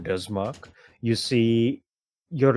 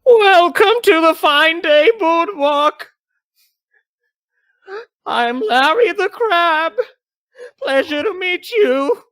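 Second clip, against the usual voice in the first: very high, loud, drawn-out mascot voice; Larry the Crab; 8 dB louder, slower than usual. Acted. Crab mascot greeting arriving families, high loud showman voice. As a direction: belt out a squeaky theme-park greeting. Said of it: "you just hear a voice going".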